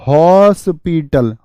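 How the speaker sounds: हॉस्पिटल stretched slowly, open हॉ vowel stressed; drawn-out hospital. Shown as a demonstration. Teacher showing students how हॉस्पिटल sounds, slow and clear. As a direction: draw out हॉस्पिटल so each sound is heard. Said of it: "तो आवाज किसकी आ रही?".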